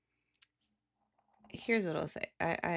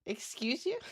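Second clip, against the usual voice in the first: higher, indignant excuse you; indignant retort; 8 semitones higher than usual. Retold or imitated. To a pushy bird, an offended higher retort. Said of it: "And I'm like".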